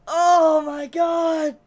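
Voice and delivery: exasperated yell. Real, not acted. Venting at the cliffhanger, a slow loud exasperated yell. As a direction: stretch it out in real exasperation.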